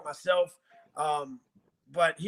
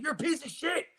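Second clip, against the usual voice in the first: retold angry insult; 9 semitones higher, 6 dB quieter than usual. Insulting shelter staff.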